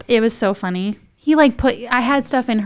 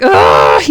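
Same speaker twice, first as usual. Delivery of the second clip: very low, loud guttural groan; exorcism groan; 10 dB louder than usual. Retold or imitated. Woman mid-contraction in bathroom, exorcism groan. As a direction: imitate a possessed, demonic growl. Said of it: "like exorcism, like".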